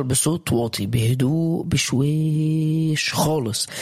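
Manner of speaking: slow, lingering delivery on a held note; drawn-out. Shown as a demonstration. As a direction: stretch the words out slowly. Saying change happens quietly.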